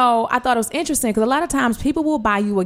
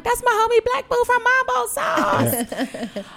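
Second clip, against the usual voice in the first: hype homie intro; slower than usual. Retold or imitated. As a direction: shout out your street name. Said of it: "I used to be like".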